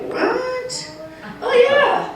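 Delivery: high incredulous what, then dry sarcasm; mock disbelief. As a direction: gasp in disbelief, then suggest it drily. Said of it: "And we're like".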